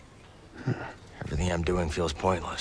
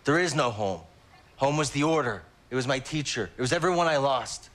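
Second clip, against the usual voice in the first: bitter outburst; 7 semitones higher than usual. Acted. Cal rejects settling down, upset.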